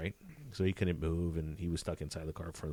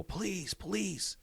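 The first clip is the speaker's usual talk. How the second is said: retold police yell; 12 semitones higher than usual. Officer retells warning bystanders during the chase, mock yelling. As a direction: imitate an urgent shout without raising the volume. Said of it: "the whole time I'm yelling now".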